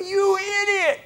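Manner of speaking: high, drawn-out outraged yell; indignant high-pitched shout; road rage. Retold or imitated. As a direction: explode at the driver who cut you off.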